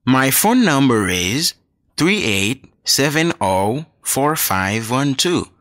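usual speaking voice

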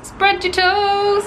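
high, slow, drawn-out mock command; playful echo. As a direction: stretch it out high, teasing.

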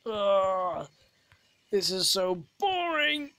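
groan, then a high moan of boredom; bored pupil. Acted. Pupil stuck in detention for an hour, exaggerated bored whining. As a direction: whine it out, pitch climbing well up. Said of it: "you have to be bored".

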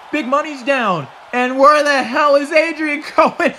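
very high, loud, frantic play-by-play; astonished commentator hollering; excited shouting. Real, not acted. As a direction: yell in shock as the match erupts. Shouting at surprise attack.

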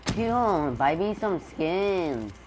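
drawn-out begging whine. Real, not acted. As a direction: stretch the words, begging hard. Begging dad for skins.

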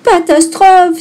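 high, loud wail of dismay; high-pitched cry of alarm. A narrator's reading. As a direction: gasp it out as a little girl in a panic. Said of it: "s'écria Boue".

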